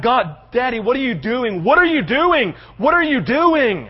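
acted anguished plea, rising to a loud wail; Isaac crying out. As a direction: cry out as a bewildered son to his father. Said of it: "picture Isaac crying out".

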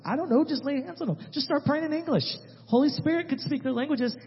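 high-pitched, hurried instructions to the team; urgent reassurance. Retold or imitated. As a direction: retell it urgently, pitched well up. Improvised order to flustered team.

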